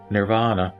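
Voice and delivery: ordinary talk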